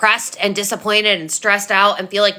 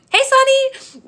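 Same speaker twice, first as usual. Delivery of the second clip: sudden high sing-song "Sonny", louder; playful callout; 5 dB louder, slower than usual. Pretend. Coach teasing a viewer, high playful call. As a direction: call out to an imaginary kid, teasing.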